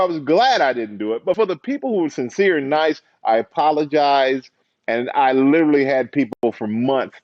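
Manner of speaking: ordinary talk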